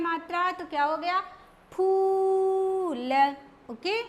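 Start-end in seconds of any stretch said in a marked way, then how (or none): 1.7-3.1 फूल very slow with the oo held and leaned on; long strong oo. Shown as a demonstration. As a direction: stretch and push the oo in फूल. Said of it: "जोर लगा के बोला".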